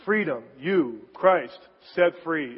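Freedom, you, Christ, set free, slowly and firmly with set stressed; slow, strong emphasis; emphatic four words. Shown as a demonstration. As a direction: lean hard on set free. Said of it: "it's very emphatic".

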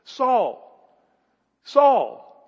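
high, loud drawn call of a name. Acted. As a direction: call the name out, voice falling away. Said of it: "in his love and mercy, calls to people".

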